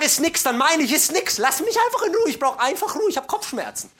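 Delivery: high, loud mock exasperation; exasperated protest. Husband begs wife to leave him alone, exasperated protest. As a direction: plead loudly to be left alone, pitch shooting up.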